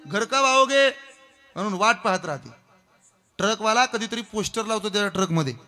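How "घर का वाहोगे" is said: high, loud pleading call; wife's plea. Acted. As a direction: call out like the waiting wife. (घराची वाट पाहणाऱ्या पत्नीची उंच, जोरात हाक)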